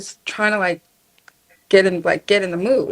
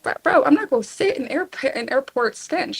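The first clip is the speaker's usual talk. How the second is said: exasperated; 4 semitones higher than usual. Retold or imitated. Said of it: "I'm like".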